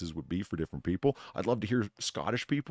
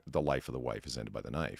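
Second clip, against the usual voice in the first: lower, softer mid-century New England announcer; old New England TV presenter; 7 semitones lower, 7 dB quieter than usual. Shown as a demonstration. Trying an accent for friends, low soft 1950s TV presenter. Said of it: "almost New England T V presenter circa nineteen fifty".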